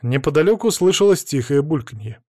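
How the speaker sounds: plain reading voice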